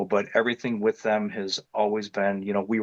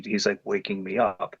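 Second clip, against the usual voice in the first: usual speaking voice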